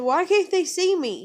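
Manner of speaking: put-on high, loud child's plea; high-pitched pleading kid voice; retold toddler voice. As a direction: imitate an upset little girl asking. Voices the distressed daughter.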